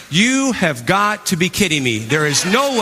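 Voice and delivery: you can't be serious, chuckling; laughing disbelief. Retold or imitated. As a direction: scoff at the idea through a laugh. Disbelief at testimonials.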